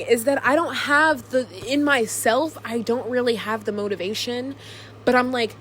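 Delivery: ordinary talk, nothing marked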